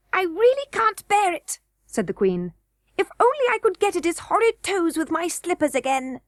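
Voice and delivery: high, fussy goblin queen voice; a greedy queen eyeing fresh meat. A narrator's reading. Goblin queen to the king, high and fussy.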